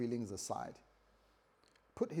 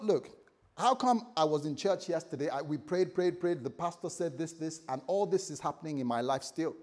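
loud, higher, exasperated venting; annoyed complaint; 4 semitones higher, 5 dB louder than usual. Acted. Church member venting about unanswered prayers, annoyed. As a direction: protest indignantly, voice raised. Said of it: "they were annoyed".

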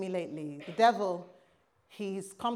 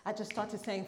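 ordinary talk, nothing marked

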